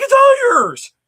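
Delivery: high, loud mock exasperation; exasperated yell. Dad yelling it's all yours at the daughter. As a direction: throw up your hands and yell it.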